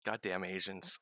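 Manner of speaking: normal talking voice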